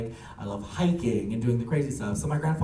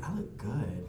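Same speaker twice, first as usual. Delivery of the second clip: acted near-whisper, pleased with oneself; hushed self-admiration; 5 semitones lower, 9 dB quieter, slower than usual. Grandchild admiring new look before going out, in hushed mock vanity.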